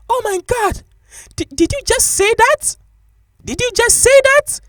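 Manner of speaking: mock-outraged, very high falsetto voice; shocked female customer. Offended customer answers the shop worker, very high mock shock. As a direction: squeal it in an exaggerated falsetto impression.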